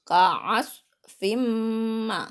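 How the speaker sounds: loud "ka'asfim" with the M held as a hum; teacher's chant lingering nasally on "fim"; nasal merged recitation. Shown as a demonstration. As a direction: hum through the end of "ka'asfim" into "ma". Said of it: "bi gunnah".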